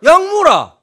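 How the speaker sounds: curt Gyeongsang dad brushing off his sick wife; gruff curt father. Retold or imitated. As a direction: bark the answer like a man who doesn't do sympathy. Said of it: "뭐라고 답했냐니까".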